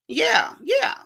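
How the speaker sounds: sudden high shout of yes. Real, not acted. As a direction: yell it out high, in full agreement. Outburst of agreement.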